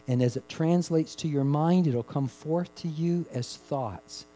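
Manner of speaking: normal talking voice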